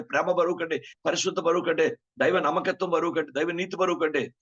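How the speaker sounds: high, loud preaching build, list hammered home; fiery preaching. Acted. Preacher to congregation, listing weights in loud fiery preaching. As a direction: thunder each weight at the congregation.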